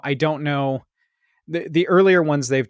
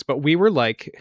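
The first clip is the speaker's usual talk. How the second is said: ordinary talk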